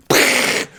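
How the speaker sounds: unvoiced lip puff for a blast; pfft explosion noise. City blowing up, mock pfft explosion sound. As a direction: puff air through the lips like a tiny explosion. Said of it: "ends with a big".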